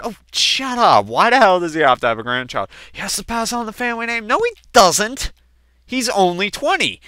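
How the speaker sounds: mock the dad as the mom would; mom's mocking voice.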